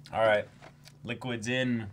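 ordinary talk, nothing marked

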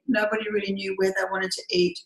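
normal talking voice

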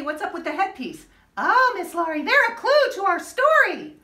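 ordinary delivery, nothing marked

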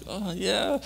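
high-pitched, put-on casual reply; faked cheerfulness. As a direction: pretend to mom that all is well. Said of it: "I'm all trying to sound, like, good and happy".

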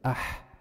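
أح as a voiceless breath from the mid-throat; breathy throat demo. Shown as a demonstration. Teacher showing the haa exit, breathing أح. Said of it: "نزل الصوت".

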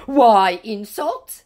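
high, slow, loud mock hurt; insulted scammer. Scammer objecting to being called an idiot, mock wounded. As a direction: wail it as an offended scammer, hammed up. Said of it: "said our now insulted scammer".

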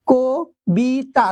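"Kabita" very slowly as ko - bi - ta; three separate syllables. Shown as a demonstration. Teacher showing three syllables, "Kabita" slowly pulled apart. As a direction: pause after each syllable of "Kabita": ko, bi, ta. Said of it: "তিনটি অক্ষর রয়েছে".

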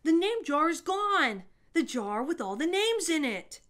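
loud, high alarmed shout; a boy shouting to the teacher in alarm. A narrator's reading. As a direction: yell it out that the jar is gone. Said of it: "Ralph shouted at him".

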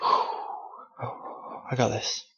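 slow, whispered and soft after a sigh. Real, not acted. Gamer psyching up before the dungeon, sighed whisper. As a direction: breathe it out to steady yourself.

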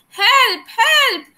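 high, panicked cry for help. Acted. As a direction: plead like a drowning ant. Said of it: "cried the ant".

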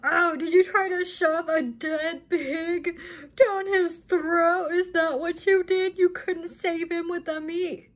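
high, whiny mock voice; exaggerated mock-crying mimicry; mocking imitation. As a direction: imitate her in a high, drawn-out mocking whine. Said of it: "She's sitting here and she's like".

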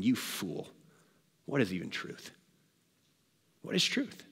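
low, soft dismissive scorn; pompous scoffing. Acted. Pilate looking back at Jesus, pompous scoffing. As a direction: toss it back quietly with contempt. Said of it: "envision Pilate just in his pomp".

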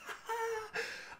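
sudden high-pitched burst of laughter. Real, not acted. Fan laughing at the Ant-Man scene, high squeal of a laugh. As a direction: let a giddy laugh escape.

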